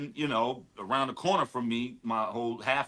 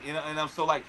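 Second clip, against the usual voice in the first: ordinary delivery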